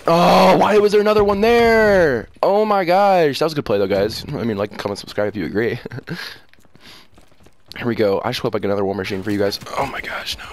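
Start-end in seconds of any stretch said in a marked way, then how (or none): 0.1-3.4 high, drawn-out complaint; frustrated wail. Real, not acted. Gamer surprised by another enemy, high frustrated whine. 9.8-10.4 hushed, breathy "no, no, no"; panicky whisper. Real, not acted. Gamer in danger mid-round, whispered dismay.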